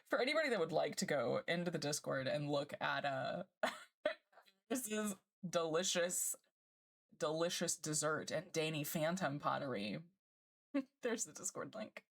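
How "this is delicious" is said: ordinary talk, nothing marked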